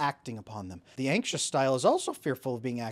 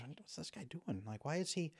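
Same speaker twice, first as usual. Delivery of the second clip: much softer, puzzled, half to yourself; puzzled murmur; 8 dB quieter than usual. Retold or imitated. Coworkers wondering aloud about him, softly puzzled. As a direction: mutter it like a confused coworker.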